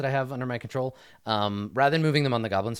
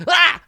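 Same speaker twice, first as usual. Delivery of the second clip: sudden high goblin shriek; goblin yell; 13 dB louder than usual. Acted. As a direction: startle them with a goblin shout. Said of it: "he's like".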